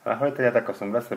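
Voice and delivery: ordinary delivery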